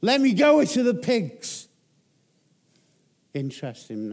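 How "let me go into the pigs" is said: much higher and louder demon plea; a pleading demonic scream. Acted. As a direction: shriek it as the desperate demons. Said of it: "They scream out".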